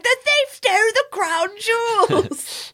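high-pitched British proclamation; British Rolf. Retold or imitated. Co-host acting out Rolf riding by in a carriage, excited British accent. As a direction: cry it out in a mock British accent. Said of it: "I want more British Rolf".

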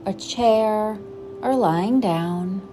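gentle, unhurried meditation guide voice; guided meditation. Acted. Host guiding listeners into breathing, calm meditation voice. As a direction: settle the listener into stillness.

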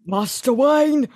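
hammed-up Cockney butler; Michael Caine impression. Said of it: "Michael Caine".

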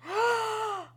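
high, sharp gasp; big astonished gasp; shocked gasp. Real, not acted. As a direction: gasp at a sudden revelation. Sudden realisation of plot twist.

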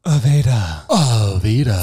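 "Aveda" stretched slow, stress on ve; drawn-out Aveda. Shown as a demonstration. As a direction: say "Aveda" slowly, savouring it. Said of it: "repeating the name".

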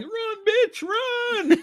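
high, loud yell of run; screaming at the screen. Retold or imitated. Friend urging the victim on, shrieking. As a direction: shriek it at the fleeing victim like a horror fan. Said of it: "and he's like".

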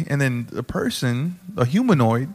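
usual speaking voice

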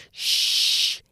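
long snake-like "shh"; hissed shush. A narrator's reading. Finnegan the snake silencing Jonah, hissed shush. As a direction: hiss a shush. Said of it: "hissed Finnegan".

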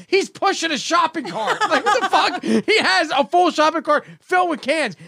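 very high-pitched, incredulous rant; retold scolding, laughing through the exasperation; exasperated dad. Dad argues homeless man was obvious, exasperated yelling. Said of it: "I yelled at my kid".

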